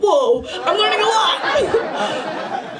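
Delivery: put-on drawn-out kid amazed; mock amazement. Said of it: "And I was like".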